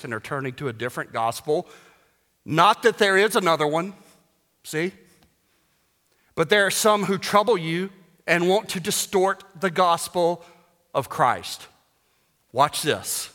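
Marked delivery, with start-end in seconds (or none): 2.4-11.7 higher, louder scripture proclamation; rising pulpit fervor; preaching. Acted. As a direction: proclaim it to the congregation. Reads scripture with rising force.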